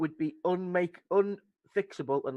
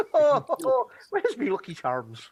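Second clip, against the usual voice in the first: mock Irish leprechaun; 7 semitones higher, 4 dB louder than usual. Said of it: "a fucking leprechaun".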